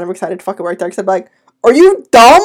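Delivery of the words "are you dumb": loud, high, mock-outraged jab; mock incredulous outburst. Worker imagining scolding gym walk-ins, mock incredulous outburst. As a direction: pretend to blow up at a clueless customer.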